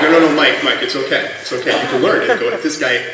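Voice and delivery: loud, laughing mock coaxing; mock urging. Teasing Mike in front of the instructor, loud laughing coaxing.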